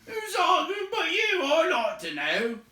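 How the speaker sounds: loud, high shouting troll; angry troll. A narrator's reading. Tom yelling at William, loud and high. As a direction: shout the accusation. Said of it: "said Tom".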